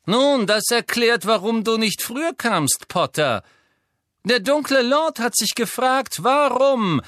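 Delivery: high, slow, sneering aristocrat; Malfoy voice. Acted. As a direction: taunt him slowly from above. Malfoy taunts Harry.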